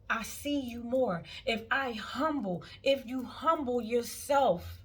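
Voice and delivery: rising to loud, high preaching; preaching at full force. Real, not acted. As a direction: build the sermon up into a cry.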